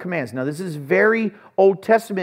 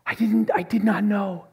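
gasp, then higher stunned denial; retold shock, pitched up; 6 semitones higher than usual. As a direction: react as if just hearing bad news. Retelling shocked reaction to death news.

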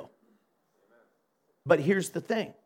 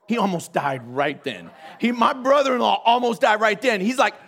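ordinary delivery, nothing marked

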